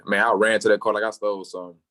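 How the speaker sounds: ordinary delivery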